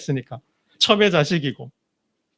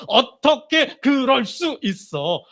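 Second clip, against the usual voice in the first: slow, higher, disapproving quote; judgmental disbelief; 5 semitones higher, much slower than usual. Retold or imitated. Preacher mimicking people who judge without empathy, slow reproachful disbelief. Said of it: "라고 이야기한다라는 거죠".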